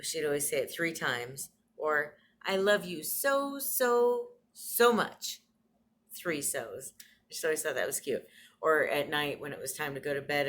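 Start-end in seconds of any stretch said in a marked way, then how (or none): 2.4-5.4 higher, slow and louder on each so; fond quote, drawn out. Retold or imitated. Granddaughter imitating grandma's three so's, tender and drawn out. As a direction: stretch the so's out lovingly. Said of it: "She used to say".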